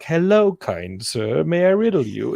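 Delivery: mock grand, lilting greeting; in-character Ren Fair performer. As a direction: imitate an overeager fair actor accosting a guest. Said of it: "Everybody's in character".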